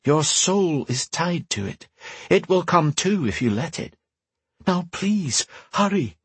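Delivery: an urgent voice from afar, kept gentle; mysterious woman voice. Acted. Telepathic woman urging.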